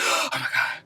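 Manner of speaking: gasp, then a breathy whisper; acted whisper of dread; horrified whisper. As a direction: whisper it in mock horror. Acting out Asher's horrified realization.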